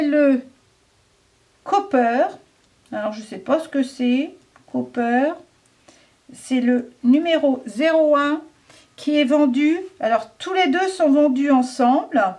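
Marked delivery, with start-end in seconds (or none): none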